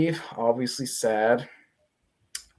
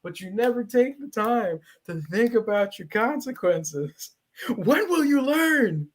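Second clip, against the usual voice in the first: high, strained mock scolding building to a shriek; hammed-up screeching tirade; 13 semitones higher than usual. Wife berating husband over consequences, hammed-up hysterical yelling. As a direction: wail it until the voice nearly gives out. Eve's tirade continues, parodied.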